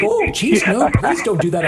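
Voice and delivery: put-on high, pleading old-lady voice; freaking out. Elderly lady pleading with prank caller, mock distress. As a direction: imitate a horrified woman begging. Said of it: "she's just, like, freaking out".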